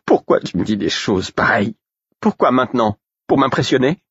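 high, desperate angry questioning; despairing, angry outburst; anger and despair. A narrator's reading. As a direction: demand answers, voice pitched high. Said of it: "à la fois de colère et de désespoir".

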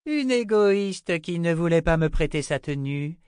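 smug cruel warrior woman. Acted. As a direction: relish it with cool menace.

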